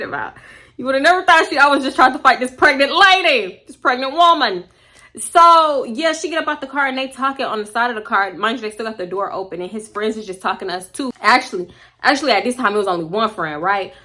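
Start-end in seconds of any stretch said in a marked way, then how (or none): none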